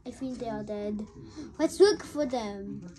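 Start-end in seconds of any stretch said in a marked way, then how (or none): 0.1-1.1 gloomy murmur. Acted. Fearing friends dead.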